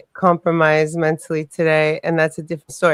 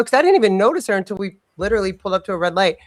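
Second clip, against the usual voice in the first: ordinary delivery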